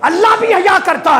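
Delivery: high, ringing preacher's climax; fervent pulpit proclamation; impassioned declamation. Acted. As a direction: proclaim the quoted saying up high. Preacher's climax of quoted saying.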